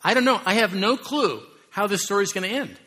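high, loud mock-befuddled God, shrugging; clueless God. Preacher mocking a clueless God on the throne, high and loud. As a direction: play God as lost and clueless. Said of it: "he's on the throne like".

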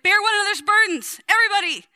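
hammed-up apostle yelling at the church; wild Paul shout. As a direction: Bear one another's burdens shouted high and loud. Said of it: "He gets wild".

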